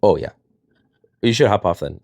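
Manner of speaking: ordinary talk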